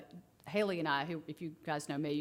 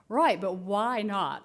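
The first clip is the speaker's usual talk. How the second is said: slow probing; 7 dB louder, much slower than usual. Retold or imitated. Therapist pressing the kids.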